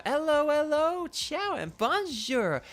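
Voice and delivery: high-pitched, showy character voice; Iono. Acted. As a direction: play her like a theatrical show host. Voicing Iono's game lines.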